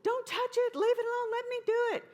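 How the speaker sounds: very high, loud panicked yell; frantic yelling. Retold or imitated. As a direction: shriek it like a woman desperate to stop you.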